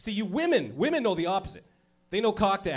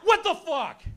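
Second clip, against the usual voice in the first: 1 panicked; 11 semitones higher, slower than usual. Retold or imitated. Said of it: "You're like".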